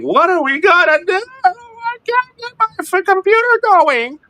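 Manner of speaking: very high, wailing falsetto; panicked caller. Acted. Distressed computer user phones for help, hammed-up high wail. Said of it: "calling Levi and saying".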